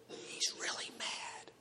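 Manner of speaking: slow, hushed aside; awed whisper. Acted. Cowed disciples to each other after the rebuke, hushed whisper. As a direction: whisper it warily under your breath.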